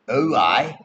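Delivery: put-on young dwarf voice; little baby dwarf. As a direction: pipe up as a cheeky dwarf kid. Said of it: "the little baby dwarf".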